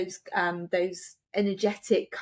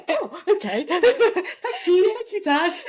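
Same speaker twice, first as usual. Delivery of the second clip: giggling thanks, higher and louder; laughing; 10 semitones higher, 6 dB louder, slower than usual. Retold or imitated. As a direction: thank her through laughter. Laughing thanks in retold exchange.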